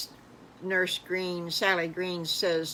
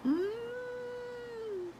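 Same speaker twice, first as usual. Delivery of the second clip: delighted gasp and hum; 6 dB quieter than usual. Acted. Piglets hear of ice cream.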